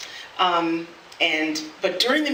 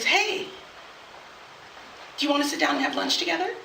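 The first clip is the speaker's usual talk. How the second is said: higher-pitched, soft-edged imitation of an inviting colleague; tentative invitation; 7 semitones higher, 5 dB quieter, faster than usual. Colleague asking her to lunch, tentative and higher-pitched. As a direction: imitate a hopeful "hey" lifted high.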